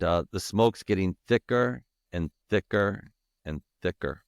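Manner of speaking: ordinary delivery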